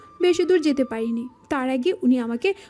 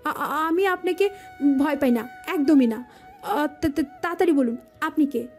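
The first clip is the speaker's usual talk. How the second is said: frightened, halting stutter; stammering false bravado. Acted. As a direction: act brave but trip over every opening syllable. Claims no fear while stammering. (ভয়ে তোতলানো বাহাদুরি)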